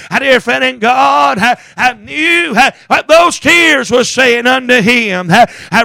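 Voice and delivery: usual speaking voice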